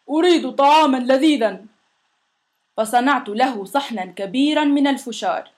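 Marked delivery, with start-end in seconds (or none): 0.1-1.6 demanding, moderately raised voice; mild cry. A narrator's reading. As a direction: cry out for it, without full force. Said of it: "صرخ الوحش الكبير". (بصراخ خفيف)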